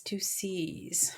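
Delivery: a soft whispered aside. Real, not acted. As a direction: whisper it as a little secret. Aside about the two C's.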